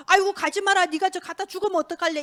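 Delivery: acted worried big brother; worried pleading. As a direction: plead with him not to go, urgently.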